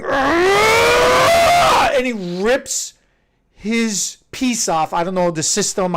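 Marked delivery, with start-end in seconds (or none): 0.0-2.0 high, loud mock roar of rage; hammed-up furious yell as he grabs his hair; uncle's yell. Uncle yanking his hair, a high loud roar. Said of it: "he starts going".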